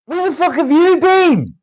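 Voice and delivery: very high, screeched demand; over-the-top shrieked mock outrage; mock scolding shout. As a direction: yell it at a long-lost mate, stretching the end. Mock scolding a returning viewer.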